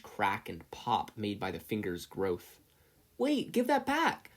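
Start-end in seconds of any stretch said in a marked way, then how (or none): 3.2-4.3 high, slow desperate yell. A narrator's reading. Izuku yelling at the bully who took his notebook. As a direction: cry out to get the book back. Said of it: "Izuku yelled".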